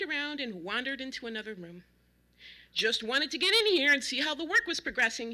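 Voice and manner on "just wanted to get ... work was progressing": loud, raised neighborly bellow; big booming casual voice; cheerful bellow. A narrator's reading. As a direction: bellow it as if announcing yourself. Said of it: "he bellowed".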